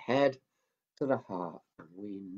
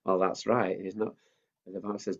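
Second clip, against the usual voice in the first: usual speaking voice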